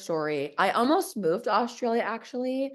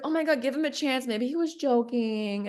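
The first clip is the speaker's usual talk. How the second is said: higher-pitched mock mom; mom's plea, pitched up; imitating pleading mom; 5 semitones higher than usual. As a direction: lightly voice a mom urging her daughter to relent. Imitating mom urging her to relent.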